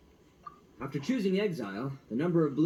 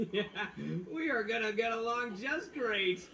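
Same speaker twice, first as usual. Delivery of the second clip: light chuckle, slowed and pleased; laughing cheerfully; 5 semitones higher, 3 dB quieter than usual. Real, not acted. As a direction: grin through it, laughing a little. Itto cheerful after agreement.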